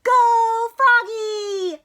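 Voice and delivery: long, high, loud cheering holler. Acted. Mom cheering Froggy from the stands, hollering. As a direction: stretch out a big slow cheer. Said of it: "hollered Mom".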